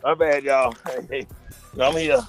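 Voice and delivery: ordinary talk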